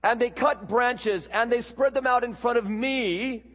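loud, high, boastful donkey proclamation; very proud donkey. Acted. Donkey bragging to a small group of donkeys, very proud. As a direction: crow it out, full of self-importance. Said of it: "said to them very proudly".

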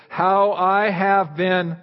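very slow, stretched phrase; slow, drawn out. Shown as a demonstration. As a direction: drag out each word to build suspense.

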